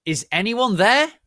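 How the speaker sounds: slow, loud, high call; yell. A narrator's reading. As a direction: call out into the dark. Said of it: "yelled Mister Norris".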